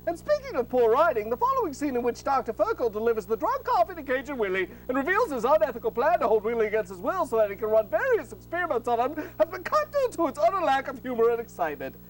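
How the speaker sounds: ordinary delivery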